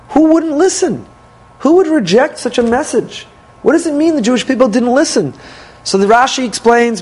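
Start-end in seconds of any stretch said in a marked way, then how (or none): 0.1-5.3 pitched up high in disbelief; incredulous. Real, not acted. Teacher asking the class in high-pitched disbelief. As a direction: ask it like it makes no sense at all.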